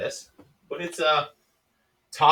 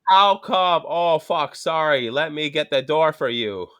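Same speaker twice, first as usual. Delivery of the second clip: loud, hammed-up Wisconsin accent; exaggerated Midwestern Wisconsin accent; 4 semitones higher, 6 dB louder, much slower than usual. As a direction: put on a broad Wisconsin drawl. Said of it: "sounds like I'm from Wisconsin".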